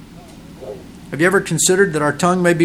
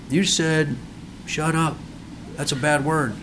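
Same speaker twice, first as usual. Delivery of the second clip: softly reporting a naughty word; hushed tattling; 9 dB quieter than usual. Retold or imitated. Child telling the preacher on him for a bad word, hushed.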